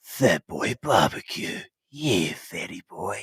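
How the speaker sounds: very low, soft, slow seductive voice; sexy voice. Pretend. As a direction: purr it like a seductive movie trailer. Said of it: "like, a sensual voice".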